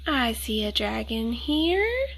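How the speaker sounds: usual speaking voice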